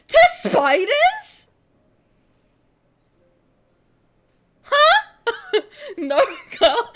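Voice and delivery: high, loud, slow question; stunned disbelief. Real, not acted. Questioning the med school friend about spider anatomy, stunned. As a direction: gape at the claim.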